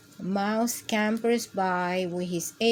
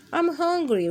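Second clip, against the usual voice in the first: mild bear roar; 8 semitones higher than usual. Acted. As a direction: play a small roar of a hungry bear. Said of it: "roars Bear".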